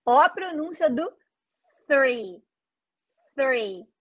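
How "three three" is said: tongue between teeth for "three"; th sound. Shown as a demonstration. Teacher models the TH in three for students, careful. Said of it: "É som de T H".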